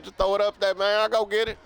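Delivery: high, loud hyped quote of the clip; imitating the famous Randy Moss clip, high and loud; quoting Randy Moss. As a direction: shout it like a cocky receiver. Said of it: "that clip of the Randy Moss".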